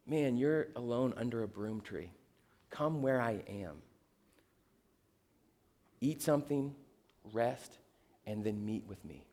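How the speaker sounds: very soft, low voice, with pauses; hushed, low, unhurried coaxing; God speaking gently. Acted. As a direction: invite him softly, barely above a murmur. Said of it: "He says".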